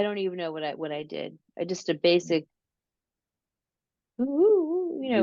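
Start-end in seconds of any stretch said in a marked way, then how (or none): 4.2-5.0 much higher hooting calls, oo oo oo; imitated Sasquatch call. Mom recreating the call she made to convince the boy. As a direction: hoot into the woods like calling a forest creature. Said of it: "I made a loud call".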